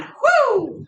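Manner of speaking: high-pitched "woo!"; quick high cheer after hard effort; celebratory whoop. Real, not acted. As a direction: let out a high whoop of relief. Whoop after finishing a set.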